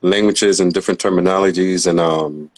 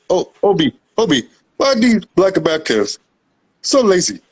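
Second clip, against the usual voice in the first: much higher, sneering imitation of a snobby newcomer; mocking Nigerian immigrant; 11 semitones higher than usual. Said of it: "And they be like".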